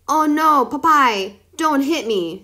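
loud, high frightened plea; frightened fox. A narrator's reading. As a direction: beg loudly not to be hit. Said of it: "said Antonio".

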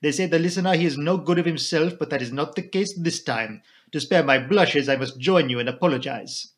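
put-on Poirot in a lightly French-accented voice; courteous Belgian detective, French accent. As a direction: gallant French-accented apology.

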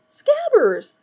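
high, loud exclamation of bewilderment; startled cry of recognition; blank surprise. A narrator's reading. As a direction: call the name in stunned amazement. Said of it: "said Ron blankly".

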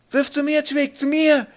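high-pitched team yell; shouting. A narrator's reading. Teammates to Twig during the game, shouting. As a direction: call urgently for the ball. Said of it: "schrien verschiedene Mitglieder seiner Mannschaft".

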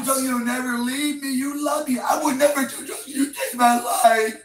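high-pitched, desperate yelling. Real, not acted. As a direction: yell a wounded plea at a partner. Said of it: "Why are you yelling?".

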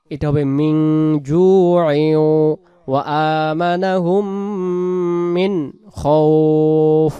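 জুইউ and খাউফ drawn out very slowly; slow recitation demo. Shown as a demonstration. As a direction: recite with long held vowels. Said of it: "এটা মিন হচ্ছে আর এটা মিং". (ধীরে টেনে পড়া)

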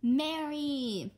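high, loud happy greeting; excited greeting. Acted. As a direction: greet her with joy.

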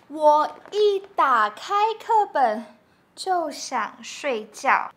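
就想睡觉 said slowly for learners; slow it down so students can follow; slow, clear model reading. Shown as a demonstration. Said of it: "teacher models the sentence".

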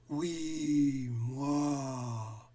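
a lugubrious voice from the dark; gloomy monster voice. Acted. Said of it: "répondit la voix lugubre".

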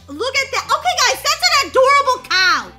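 high, loud, laughing gush; excited laughing praise. Real, not acted. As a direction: squeal with laughter at the cute cow. Gushing over cow, laughing.

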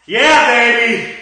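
loud, drawn-out victory cry; triumphant cheer. Real, not acted. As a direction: celebrate finishing the workout. Cheer after last reps.

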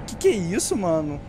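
ordinary talk

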